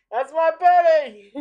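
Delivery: put-on slow, loud old man voice; cranky old codger, drawn out; old prospector.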